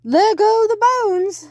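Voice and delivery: high loud slow call; boy pointing out the bones; excited shout. Acted. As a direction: exclaim with wonder. Chase scene.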